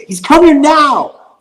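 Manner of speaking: high, drawn-out panicked yell into the phone; retold scream. Girlfriend phones him in a panic, screaming. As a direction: imitate her screaming down the phone. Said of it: "just screaming on the phone".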